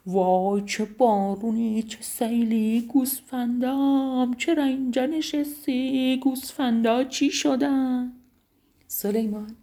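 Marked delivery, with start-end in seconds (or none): 0.1-8.1 slow, drawn-out lament, rising higher; wailing. A narrator's reading. Master Rajab Khan wailing as he reaches his shepherd, slow lament. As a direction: moan it like a ruined man.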